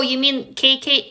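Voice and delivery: usual speaking voice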